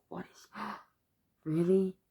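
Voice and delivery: retold stunned reaction with a gasp; taken aback, catching the breath before "really"; overwhelmed disbelief. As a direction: gasp mid-phrase, reliving the surprise. Retelling stunned reaction to aunt's generosity.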